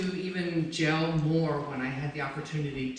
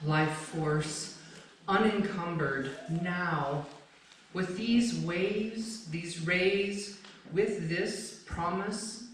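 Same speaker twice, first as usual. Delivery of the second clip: slow, measured poetry recital with long pauses; poem reading; 2 semitones higher, slower than usual. Acted. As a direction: let each image land, unhurried. Reads own poem aloud.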